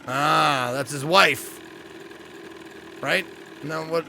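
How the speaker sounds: ordinary talk, nothing marked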